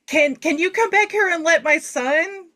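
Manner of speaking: high-pitched hesitant request; imitated pleading mother. Lady in VIP area asking for her son, mock pleading. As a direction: mimic a lady asking a favour.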